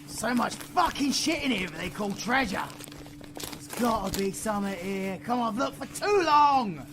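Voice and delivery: rising frantic shouts of frustration; frustrated yelling. Acted. V searching the vault, yelling in frustration.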